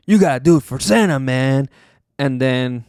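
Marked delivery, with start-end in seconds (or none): 0.1-1.7 mock pep talk. Booker T urging Cena on, mock fired-up. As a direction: pump him up, hammed-up. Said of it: "he's like".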